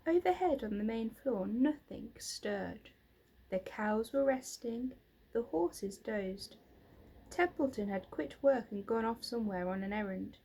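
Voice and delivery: ordinary delivery, nothing marked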